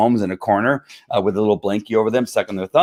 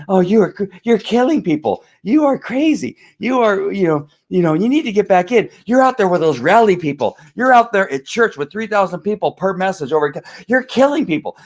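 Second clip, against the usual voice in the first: much higher whining mock voice; mocking whiner; 8 semitones higher than usual. Host mocks fearful men, much higher whiny voice. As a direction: sneer at them in a squeaky panic.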